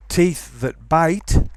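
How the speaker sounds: slow, loud TEETH THAT BITE with hissed th ending TEETH; voiceless TH. Shown as a demonstration. Teacher gives the model, voiceless TH in TEETH. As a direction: keep the end of TEETH unvoiced. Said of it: "do not voice the voiceless sound".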